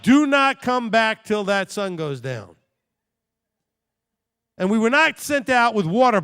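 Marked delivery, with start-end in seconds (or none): 0.0-2.6 slow, loud, deliberate command; stern order. Retold or imitated. Parents sending kids out, slow loud command. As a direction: spell out each word like a fed-up parent. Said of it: "They were like".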